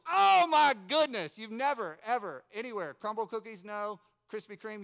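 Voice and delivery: loud, high rave, first word stretched; ecstatic amazement. Retold or imitated. Preacher relives his first bite of orange, ecstatic. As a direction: gush at full volume over the taste.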